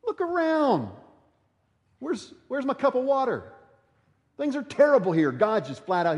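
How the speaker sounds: high whining mock complaint; whiny complaint. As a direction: whine about the water. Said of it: "the sense you get there".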